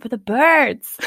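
put-on playful American accent on for the birds. British host tries an American accent for fun. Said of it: "I'm gonna say it in an American accent".

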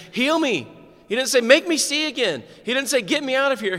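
high, loud imitated begging; mock pleas. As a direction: cry out the false requests, high and loud.

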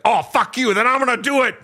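high, shouted mock tantrum of a rebellious kid; defiant teen outburst. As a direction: yell it like a teenager slamming back at a parent. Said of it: "it's like".

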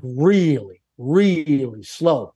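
drawn-out, plodding delivery; slow it right down to show the crawl; dragged out slowly. Retold or imitated. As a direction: stretch really slow out, very slow. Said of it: "we're going like a bunch of kids".

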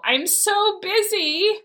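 put-on high, drawn-out toddler whine; whining two-year-old. Mom mimics her two-year-old refusing to pick up a blanket, whining. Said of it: "your little two-year-old gets on the floor".

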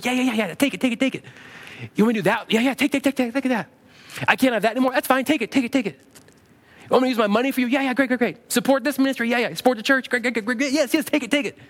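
eager giving. Acted. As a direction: act out handing it all over without hesitation. Acting out eagerly giving everything to God.

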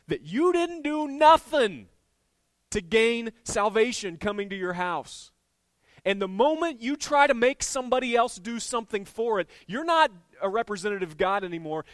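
preacher shouting grace to the church; full-force preaching. Acted. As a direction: thunder it at the congregation.

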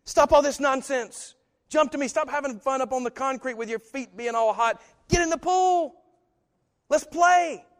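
high, loud, joyful call to jump in; God calling out, high and loud. Acted. God calls his children into the pool, high loud excited call. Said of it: "he's saying".

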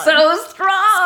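high, loud swooning cry; gushing excitement. Acted. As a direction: gush it out, laughing. Gushing over old boyfriend's strength.

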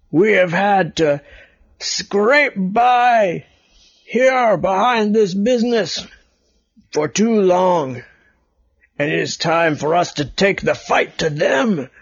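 a hint of a stage rat rousing others; rat leader speech. Rat leader on cardboard box.

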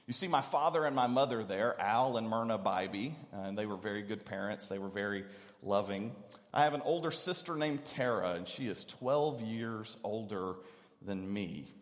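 ordinary talk, nothing marked

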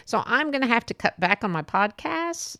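ordinary delivery

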